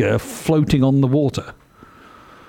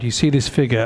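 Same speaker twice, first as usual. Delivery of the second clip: ordinary talk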